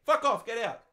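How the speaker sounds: loud, high, forceful command; emphatic order. Real, not acted. Host urging a teenager to move out, emphatic shouted order. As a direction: order them out loudly. Urging listener to leave home.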